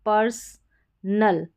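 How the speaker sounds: पर्सनल very slow, split "purs / nul"; syllable by syllable. Shown as a demonstration. Teacher showing how PER is read, पर्सनल slowly in two pieces. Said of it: "पीईआर को पर करके पढ़ा जाएगा".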